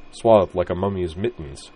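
ordinary delivery

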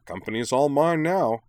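high, loud, pleased crowing; happy gloating. A narrator's reading. Ryo claims the company, happy gloating. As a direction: claim the prize gleefully. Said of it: "said Ryo happily".

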